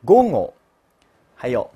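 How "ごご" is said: "ごご" with a nasal ng-like g. Shown as a demonstration. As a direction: voice "ごご" through the nose. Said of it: "我读鼻浊音".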